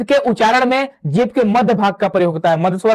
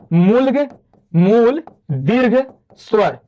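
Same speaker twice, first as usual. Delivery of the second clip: slow dictation; much slower than usual. Shown as a demonstration. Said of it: "dictating term slowly".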